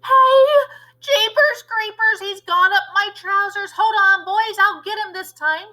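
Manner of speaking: high, loud panicked cook voice; startled cook. Acted. Cook with a mouse up his trousers, high startled yell. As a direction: yelp in shock then rally the boys.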